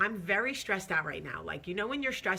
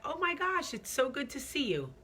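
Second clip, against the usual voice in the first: high-pitched mock gushing; imitating an over-friendly hello, pitched way up; imitated delighted greeting; 9 semitones higher than usual. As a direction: gush as if greeting an old friend. Acts his delighted greeting.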